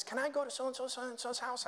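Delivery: high, soft imitation of a girl; daughter asking permission. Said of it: "she came home from school and she says".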